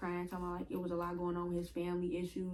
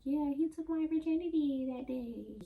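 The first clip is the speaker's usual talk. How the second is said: imitating the girl; 8 semitones higher than usual. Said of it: "and she like".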